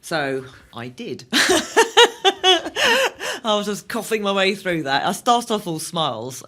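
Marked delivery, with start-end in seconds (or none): 1.3-3.4 high loud burst of laughter; big laugh. Real, not acted. Laughing at the host after admitting she ran. Laughing at her own stubbornness.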